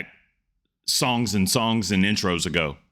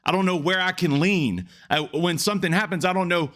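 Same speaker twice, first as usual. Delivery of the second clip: climbing higher and higher into panicked pleading; desperate plea to God; 7 semitones higher than usual. Retold or imitated. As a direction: ask God, lost and frightened, pitch rising all the way. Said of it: "he's looking up at God like".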